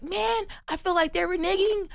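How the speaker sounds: high-pitched whine of disbelief; dismayed complaint. Retold or imitated. Actor venting to brother about another audition, high-pitched dismay.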